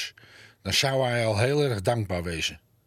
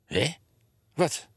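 suspicious; 4 semitones higher, slower than usual. A narrator's reading. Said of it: "vroeg de fluit wantrouwend".

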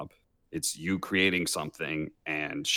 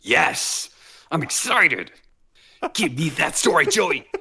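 excited; 9 semitones higher, 5 dB louder, slower than usual. Real, not acted. Eager for friend's story.

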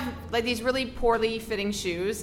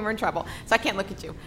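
ordinary talk, nothing marked

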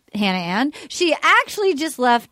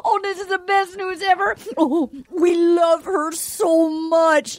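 high, hammed-up happy wailing; hysterical joy; 9 semitones higher, much slower than usual. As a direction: sob and shriek like a jackpot winner. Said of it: "her reaction, if someone had walked in with a big check".

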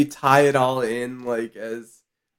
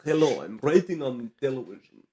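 put-on Russian accent; 7 dB quieter than usual. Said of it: "a Russian accent".

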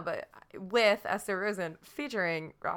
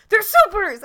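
high, loud cry of discovery; mock alarmed shout; 13 semitones higher, 14 dB louder than usual. Quoting the guard who spots the kids, high excited shout. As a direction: shout it like a startled henchman.

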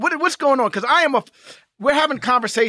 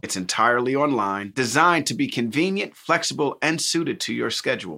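lower, even sponsor read; 9 semitones lower than usual. Acted. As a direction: read the ad smoothly.

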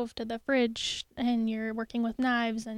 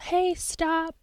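parent's warning; 6 semitones higher than usual. Acted. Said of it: "probably a little bit more aggressive than that".

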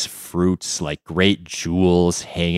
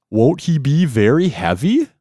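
jellyfish voice; 7 semitones higher, 4 dB louder than usual. Acted. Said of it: "queried the jellyfish".

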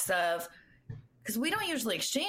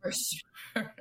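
breathy whispered repeat; whispered echo; 4 semitones higher, 4 dB quieter than usual. Real, not acted. Friend echoing the joke, whispered and knowing. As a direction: echo it back under your breath.

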